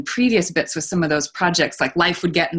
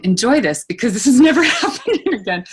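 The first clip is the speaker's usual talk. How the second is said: higher, louder, breaking into a laugh; laughing retold thought; 6 semitones higher, 5 dB louder than usual. Diver telling herself to savor the one dive, laughing. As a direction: act out the panicked thought, amused.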